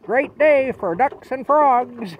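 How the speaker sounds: high, loud and very slow; jolly rainy-day greeting. Retold or imitated. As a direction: relish the rain like it's a gift. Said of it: "he'd say".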